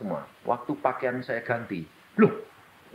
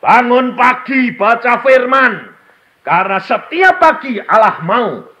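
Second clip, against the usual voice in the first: high, loud, slow sermon shout; booming preacher voice; full-force preaching; 13 semitones higher, 16 dB louder, slower than usual. Acted. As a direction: proclaim it to the whole hall.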